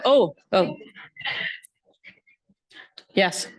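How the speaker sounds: ordinary talk, nothing marked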